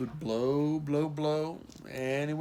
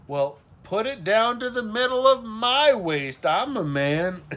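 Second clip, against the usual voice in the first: put-on higher, louder indignant kid protest; indignant child; 9 semitones higher, 8 dB louder than usual. A narrator's reading. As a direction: protest loudly like an insistent little boy.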